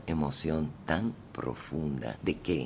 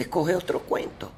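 higher, breezy writer voice; writer's reply; 12 semitones higher than usual. Retold or imitated. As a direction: toss off the advice lightly. García Márquez answering her request.

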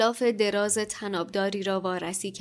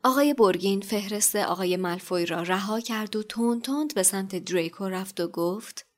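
ordinary talk, nothing marked

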